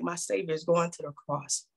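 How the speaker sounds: low, soft imitated lament; lightly acted grief, dropped low and soft; mournful Peter, voiced without real sobbing; retold weeping. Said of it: "Peter is now weeping like".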